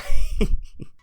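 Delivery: sudden high squeal of a laugh; a high, loud laughing squeal; burst of laughter. Real, not acted. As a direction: laugh out loud at your own joke. Laughs at own joke.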